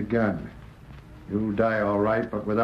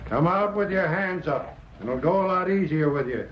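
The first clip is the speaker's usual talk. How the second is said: calling out; 7 semitones higher than usual. Acted. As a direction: call out into the cave. Calling into the dark cave.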